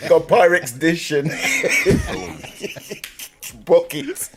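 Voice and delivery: laughing through the list. Real, not acted. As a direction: giggle out the words. Teasing, laughing about containers.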